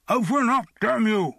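high, loud cry through a lid; muffled shout. Acted. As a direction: yell to be let out. Trapped man pounding, calling out.

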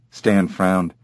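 usual speaking voice

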